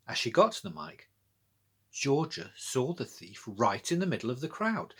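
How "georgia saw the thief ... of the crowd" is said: ordinary delivery, nothing marked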